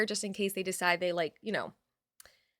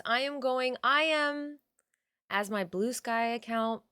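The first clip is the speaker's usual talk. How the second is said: normal talking voice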